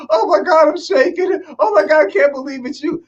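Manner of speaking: high-pitched gushing fangirl impression; starstruck fan. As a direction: imitate a fan overcome with excitement. Acted overwhelmed fan.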